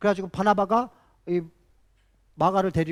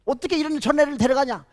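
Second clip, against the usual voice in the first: Paul refusing Mark, raised voice; mock quarrel, louder and higher; imitating angry shouting; 4 semitones higher, 6 dB louder than usual. Paul arguing with Barnabas about Mark, loud retold shouting. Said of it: "큰 소리로 막 싸우면서".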